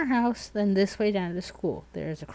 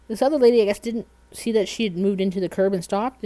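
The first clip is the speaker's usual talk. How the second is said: normal talking voice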